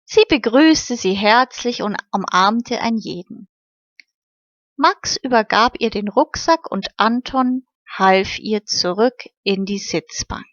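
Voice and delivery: ordinary delivery, nothing marked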